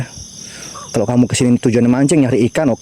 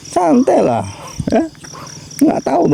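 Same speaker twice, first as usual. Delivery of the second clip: high squeak; 13 semitones higher than usual. Real, not acted. Menenangkan diri di makam.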